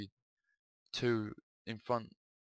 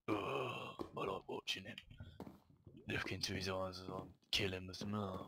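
sinister murmur; 2 semitones lower, 7 dB quieter than usual. Acted. Dark inner voice speaks.

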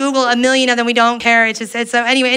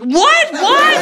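high, incredulous repeated what; shocked; 14 semitones higher than usual. Retold or imitated. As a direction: gasp it out in disbelief. Shock at dick pic.

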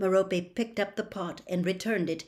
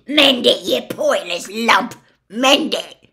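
furious scream, much louder; 4 semitones higher, 12 dB louder than usual. A narrator's reading. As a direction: scream the order, pure fury. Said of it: "Gaunt screamed".

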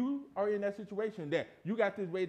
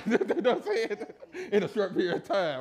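laughing; 5 semitones higher, 8 dB louder than usual. Real, not acted. Laughs at own bipolar joke.

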